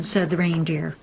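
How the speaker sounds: plain reading voice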